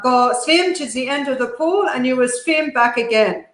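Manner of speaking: a hint of her forceful Dutch father's accent; thick Dutch accent. Dutch father giving his daughter a swimming order, mild Dutch accent. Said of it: "a very thick Dutch accent".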